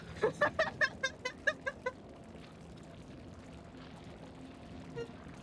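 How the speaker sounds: high, wheezing laughing fit; real uncontrollable laughter; helpless laughter. Real, not acted. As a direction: laugh until the voice gives out. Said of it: "Oh, I'm crying".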